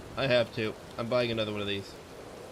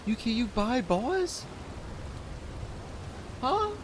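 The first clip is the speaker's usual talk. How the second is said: high, slowed disbelief; incredulous question; 10 semitones higher, 3 dB quieter, slower than usual. Real, not acted. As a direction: ask it like you can't believe it. Disbelief at purchases.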